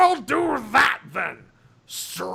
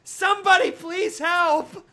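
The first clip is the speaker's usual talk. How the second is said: higher, louder desperate plea; pleading for help; 8 semitones higher, 4 dB louder than usual. Acted. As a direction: beg for help through a door. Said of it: "pounded on the door".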